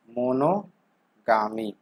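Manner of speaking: मोनोगामी drawn out very slowly, syllable by syllable; slow spelled-out repeat. Shown as a demonstration. Teacher repeating the new word for students, very slow. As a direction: stretch मोनोगामी slowly so students catch each part. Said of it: "मोनोगामी, (first take)".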